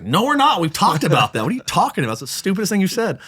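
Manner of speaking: high, scoffing retort; lightly voiced exasperated wife; mock wife refusing. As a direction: shoot the idea down as silly. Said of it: "She's like".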